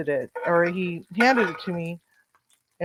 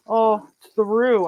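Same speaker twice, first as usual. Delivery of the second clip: slow, higher, louder imitation of the cook; mock cook's excuse; 5 semitones higher, 5 dB louder than usual. Chef imitating the cook's excuse, slow and high. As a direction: mimic his slow, pleading excuse. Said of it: "I go".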